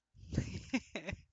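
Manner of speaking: high, breath-filled giggle; amused laugh. Real, not acted. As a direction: burst of real giggling at own slip. Amused by own wording slip.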